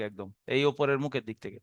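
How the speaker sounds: normal talking voice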